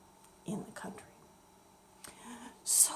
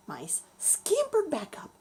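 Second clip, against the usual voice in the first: plain reading voice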